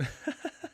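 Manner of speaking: a squeaky burst of laughter; laughing hard at the directions; high breathy laugh. Real, not acted.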